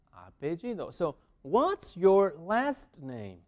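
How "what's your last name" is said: very slow, each word separate; drawn-out, deliberate model question; slow teaching model. Shown as a demonstration. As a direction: space out the words for learners.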